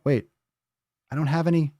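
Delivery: ordinary talk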